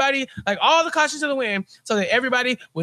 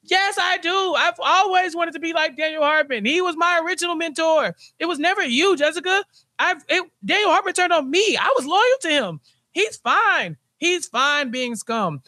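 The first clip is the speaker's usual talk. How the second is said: much higher, giddy eager whine; mock Lewis gushing over his mentor; higher-pitched mocking Lewis voice; 8 semitones higher than usual. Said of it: "And Lewis is like".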